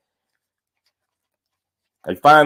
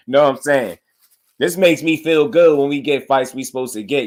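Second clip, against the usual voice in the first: ordinary delivery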